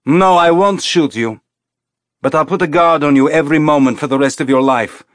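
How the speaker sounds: high, loud menacing threat; angry threat. A narrator's reading. Colonel threatening Teresa with lifelong guard, angry. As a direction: promise it coldly and loudly.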